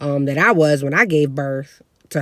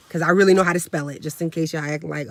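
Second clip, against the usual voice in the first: normal talking voice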